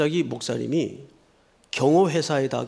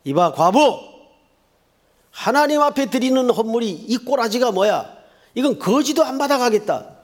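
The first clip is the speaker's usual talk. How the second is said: high, raised, indignant shout; thunderous scolding; 7 semitones higher, 5 dB louder than usual. Acted. Priest berating a poor widow over her offering, angry shout. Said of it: "벼락같이 소리를 지릅니다".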